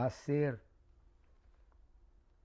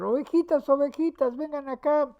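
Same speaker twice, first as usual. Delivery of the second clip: high, loud call across the field to the flock; calling out to sheep; 12 semitones higher, 13 dB louder than usual. Retold or imitated. A stranger calls the grazing sheep, high, loud shout. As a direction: shout for the sheep to come, much higher and louder. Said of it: "uno empieza a gritar".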